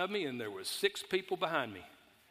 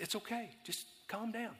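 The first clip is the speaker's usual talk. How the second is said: much softer, higher soothing voice; calming the cashier; 5 semitones higher, 9 dB quieter than usual. Retold or imitated. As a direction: hush her gently. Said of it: "I said".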